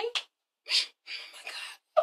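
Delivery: very slow, breathed-out amazement; awestruck whisper. Acted. Girl amazed that Derek can sing, awestruck whisper. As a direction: whisper it long and slow, as if stunned. Acts swooning at Derek singing.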